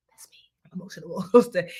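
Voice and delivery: playful whisper with a laugh; whispered aside. Real, not acted. Teacher confiding to students, whispered with a laugh. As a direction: murmur it like a secret.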